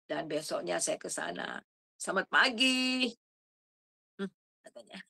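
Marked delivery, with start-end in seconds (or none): 1.9-3.1 cheerful greeting. Retold or imitated. Greeting the cold vegetable seller.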